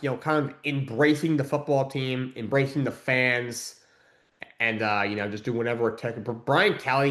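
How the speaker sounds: ordinary delivery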